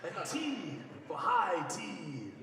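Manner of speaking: terrible Vegeta impression. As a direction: drag it out like a stuffy prince at tea. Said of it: "That's my terrible Vegeta impression".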